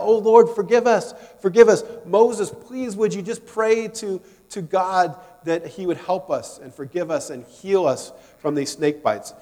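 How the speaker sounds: high, loud desperate plea; crying out for mercy. Retold or imitated. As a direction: beg God and Moses for help. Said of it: "And they cry out".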